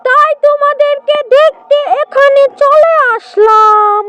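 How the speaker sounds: high, friendly animal-character voice; lightly voiced sly jackal.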